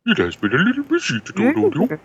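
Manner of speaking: high quacky duck voice. Acted. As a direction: read it like a cartoon duck. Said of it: "But in the duck voice".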